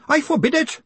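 high-pitched furious outburst; frantic, outraged cry; screamed. A narrator's reading. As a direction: forbid it in a shrieking voice. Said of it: "screamed Grinium".

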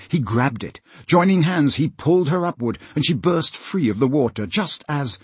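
plain reading voice

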